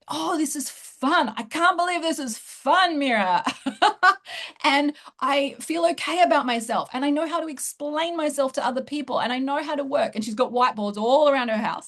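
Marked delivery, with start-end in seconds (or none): 0.1-4.6 mock-overwhelmed with joy, breaking into a laugh; imitating happy crying. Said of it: "she's crying for the opposite reason now". 4.6-10.2 high, louder joyful quote; retold delight, pitched up; imitating happy crying. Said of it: "she's crying for the opposite reason now".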